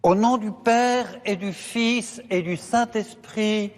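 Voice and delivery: ordinary delivery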